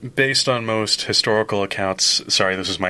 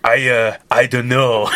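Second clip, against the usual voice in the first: put-on laughing through a playful French accent, louder; mock French Lafayette, half-laughing; 2 semitones higher, 6 dB louder, slower than usual. Reading Lafayette's line to the co-host in a laughing French accent. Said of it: "You don't have to do the French accent".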